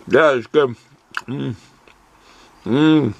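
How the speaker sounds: talking with a mouth full; chewing through the words. Real, not acted. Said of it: "Sorry for talking my mouth full".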